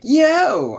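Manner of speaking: high mock holler, drawn from a game; playful high-pitched call-out; imitated villager yell. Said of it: "villagers yell at you".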